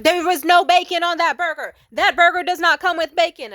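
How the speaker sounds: furious high screamed retort; screaming. Retold or imitated. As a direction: scream it in the customer's face, imitating the waitress. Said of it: "she started screaming in my face".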